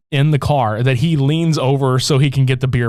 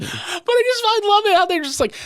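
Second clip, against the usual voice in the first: normal talking voice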